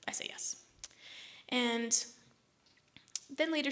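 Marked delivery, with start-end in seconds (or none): 0.1-0.6 a resigned little whisper; whispered reluctant yes. Retold or imitated. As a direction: breathe the yes out, defeated. Quietly giving in to friend.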